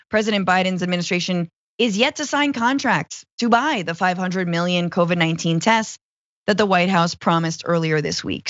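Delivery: ordinary talk, nothing marked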